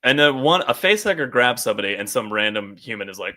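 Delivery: ordinary talk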